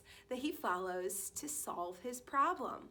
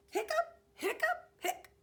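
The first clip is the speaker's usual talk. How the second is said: high, loud squeaky hiccup sounds; 5 dB louder than usual. Acted. Reading a story to kindergarteners, high loud hiccups. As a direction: hiccup it out like a big dog.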